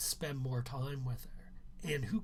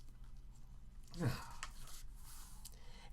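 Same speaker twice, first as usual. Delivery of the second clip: long, breathless lovesick sigh; long lovesick sigh over Chill's mother; dreamy sigh; 11 dB quieter than usual. Acted. Narrator swoons over the mother.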